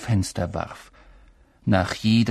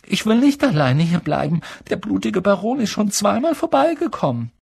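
higher, louder frightened pleading; scared boy; 10 semitones higher, 4 dB louder than usual. A narrator's reading. Neville scared of the Baron.

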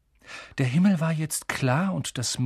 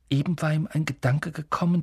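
plain reading voice

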